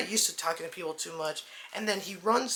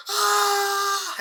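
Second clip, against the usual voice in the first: a huge, high, hammed-up scream of fake pain; faked pained scream; 13 semitones higher, 8 dB louder than usual. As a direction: scream as if fingernails are ripped out, but faking. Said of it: "and Nathan's like".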